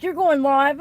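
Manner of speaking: quoting him, surprised and loud; husband's question. Retold or imitated. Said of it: "He's like".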